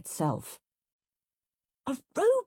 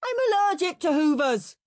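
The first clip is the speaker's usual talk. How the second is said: high, loud howl of protest; 13 semitones higher, 5 dB louder than usual. A narrator's reading. Henry dodging the hoovering, howled. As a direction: howl it like an outraged boy. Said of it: "howled horrid Henry".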